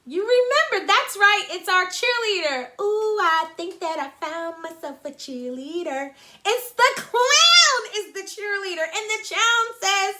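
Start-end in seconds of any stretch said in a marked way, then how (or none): 0.1-8.8 high, loud cheerful lift; excited. Real, not acted. Teacher cheering about the clown, high and loud.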